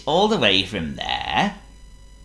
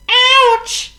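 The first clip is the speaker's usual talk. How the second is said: very high, loud squeaky yelp; shrill cartoon monkey ouch; 10 dB louder than usual. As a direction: squeal like a hurt little monkey. Chatter hurts tail in door.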